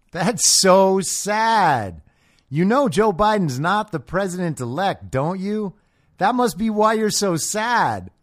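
high, slow, sugary sarcastic sympathy with a laugh; mock pity. Runner taunts the lady over Biden, mock sympathy. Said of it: "And I go".

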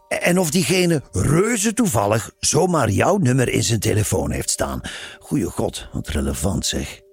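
sarcastic list, climbing then dropping dismissively; mock the triviality of her questions; cynical. A narrator's reading. Simon scoffing at the nun's nosy questions, cynical. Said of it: "zei Simon cynisch".

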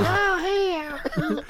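squeaky high imitation, way up in pitch. Imitating the neighborhood guy talking to himself, high-pitched. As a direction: put on a very high voice. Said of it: "He has a really high-pitched voice".

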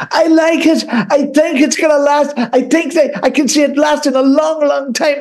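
high, sing-song judge voice; mock Louis Walsh. Mocking Louis Walsh passing by.

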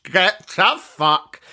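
very slow, every word stretched; drawn-out mock dismissal. Dismissing a pricey present request, slow mock outrage. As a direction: stretch it out in scornful disbelief.

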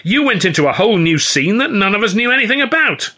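high, furious accusation; angry. A narrator's reading. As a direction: tear a strip off him. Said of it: "as angry as I'd ever seen him".

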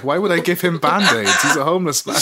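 hammed-up puzzled question; baffled retort. Hearing band-aid advice, playing baffled. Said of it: "I was like".

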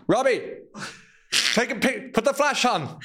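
high-pitched urgent call to a helper; calling out orders. Acted. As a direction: order your assistant about, comically high. Plays photographer ordering his friend Robbie.

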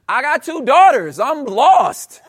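ordinary delivery

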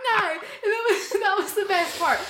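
high laughing, words slowed and squeezed out through giggles; laughing hard. Real, not acted. Telling a friend about the creepy driver, laughing hard. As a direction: laugh through it, barely able to speak.

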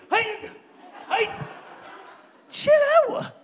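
high, loud laughing call; laughing shout. Real, not acted.

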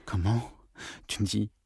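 stunned hush. Acted. Jean stunned by insinuation.

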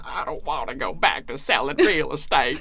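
a light imitation of a British actor's Texas accent; mock Texas accent. Podcaster quoting the villain's line in a mock Texas accent. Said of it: "wonderful Texas accent".

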